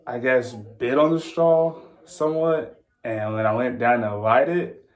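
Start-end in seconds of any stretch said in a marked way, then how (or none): none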